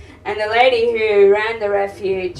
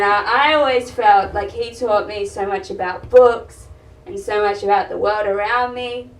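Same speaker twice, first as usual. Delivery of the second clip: usual speaking voice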